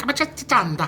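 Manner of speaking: high, sing-song Italian pronunciation of Amatriciana; Italian accent. Retold or imitated. Mocking how Italians say it, exaggerated Italian accent. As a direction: roll out Amatriciana like an Italian nonna. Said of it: "you know how they talk".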